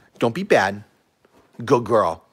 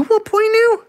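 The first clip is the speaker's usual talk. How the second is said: squeaky dog talk; 3 dB louder than usual. Real, not acted.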